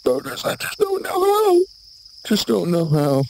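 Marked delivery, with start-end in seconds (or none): 0.4-1.6 very high shouted wail; comic wail. Acted. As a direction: wail it in a strained falsetto. Comic wail over boaters.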